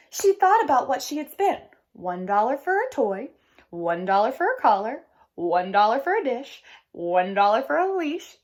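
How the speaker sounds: lively storybook read-aloud with voiced characters; reading to children. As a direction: read it like story time for kids. Teacher reads picture book aloud.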